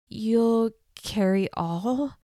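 slow, strained breathless question. A narrator's reading. Hurt girl on the floor questions Stone, breathless and slow. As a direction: push the words out through rib pain. Injured girl with broken rib speaks.

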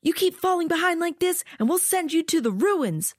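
high, menacing soldier voice; threatening soldier. Acted. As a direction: threaten the recruit.

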